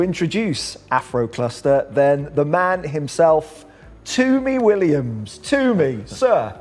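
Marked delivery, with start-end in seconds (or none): none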